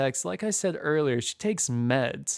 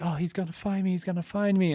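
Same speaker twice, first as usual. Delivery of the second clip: higher, hushed mock panic; mildly panicked imitation; 5 semitones higher, faster than usual. Lucy telling the storyteller she'll be found, mildly mock-panicked. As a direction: lightly act out someone freaking out. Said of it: "freaking out. She's crying, almost hyperventilating".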